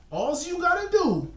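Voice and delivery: a cartoon baby's high voice, fully put on; Tommy Pickles voice. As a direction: pitch it up like a bossy cartoon toddler. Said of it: "in my Tommy Pickles voice".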